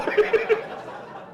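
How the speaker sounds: high wheezy laugh; real laugh after the punchline. Real, not acted. Teller amused by his own pilgrim story, laughing.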